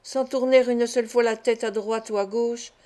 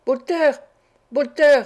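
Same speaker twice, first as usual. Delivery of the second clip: loud, higher calls, each word pressed; sinister rousing shout; fiendish call; 4 semitones higher, 8 dB louder than usual. A narrator's reading. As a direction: wake the boy with a devilish call. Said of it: "en appuyant sur chaque parole".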